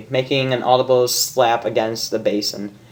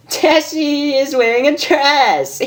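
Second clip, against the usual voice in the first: giggly; 13 semitones higher, slower than usual. Acted. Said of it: "broke out into a fit of giggles".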